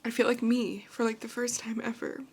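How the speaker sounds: emotional, close to tears; happy crying. Real, not acted. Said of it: "These are happy tears".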